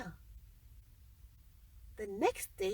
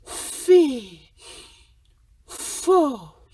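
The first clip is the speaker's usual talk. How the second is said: fee fi fo fum boomed out very loud; thundering, hungry giant sniffing the air; booming giant; 3 semitones lower, 9 dB louder, slower than usual. Acted. Giant smells a boy.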